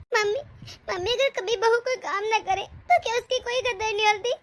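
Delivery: tearful, wavering question; crying. Acted. Daughter-in-law crying to her mother about in-laws. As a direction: ask it through tears, hurt. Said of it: "अरे बेटा रोना बंद कर".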